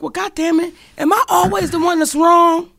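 exasperated outburst. Retold or imitated. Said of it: "I know I'm like".

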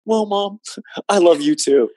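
high, laughing ironic comeback; laughing reply. Retold or imitated. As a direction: toss it back with a laugh.

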